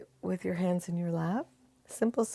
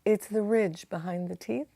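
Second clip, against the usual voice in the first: normal talking voice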